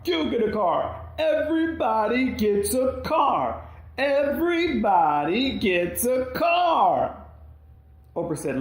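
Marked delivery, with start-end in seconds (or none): 0.0-7.2 much higher, louder, breaking shout; voice cracking, shouting. Retold or imitated. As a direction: scream it as the voice cracks. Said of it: "Her voice starts cracking and breaking".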